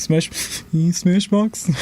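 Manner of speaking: slow drawn-out name through laughter; laughing. Real, not acted. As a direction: savor the name while cracking up. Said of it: "That should be your name on Smash".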